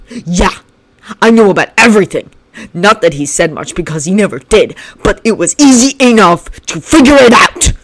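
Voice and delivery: loud, sharp barked words; barking. Acted. Shinso barking at Bakugo, loud. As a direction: bark it at him. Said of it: "he barked".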